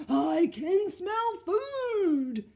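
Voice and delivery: very loud, high, slow bear bellow; bear yelling. Acted. The hungry bear calling from nearby, slow loud yell. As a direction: yell it out from the trees. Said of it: "yelled a voice".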